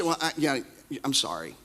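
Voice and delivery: soft, low mumbled sorry; sheepish backing down; meek apology. Retold or imitated. Spouse giving in to a fight, meek and soft.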